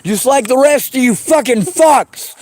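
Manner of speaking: ordinary talk, nothing marked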